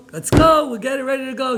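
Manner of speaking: high, loud and eager; friends calling eagerly. Retold or imitated. Friends arrive to pick her up, eager high call.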